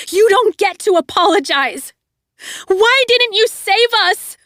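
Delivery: high, loud and tearful, slowing at the end; raging. Acted. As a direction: hurl the blame at him. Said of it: "Her voice rose, ten years of rage".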